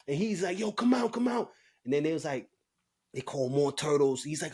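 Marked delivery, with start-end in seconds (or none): 0.6-1.5 calling out. Retold or imitated. Guards calling inmate out.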